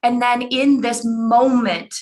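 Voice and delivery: ordinary talk, nothing marked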